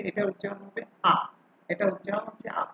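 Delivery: normal talking voice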